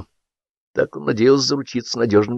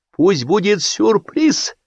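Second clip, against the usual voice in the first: slow, high; 10 semitones higher, much slower than usual. A narrator's reading. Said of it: "сказал Белявский".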